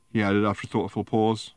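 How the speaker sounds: usual speaking voice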